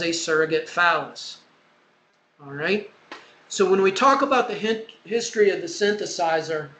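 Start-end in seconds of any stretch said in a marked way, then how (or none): none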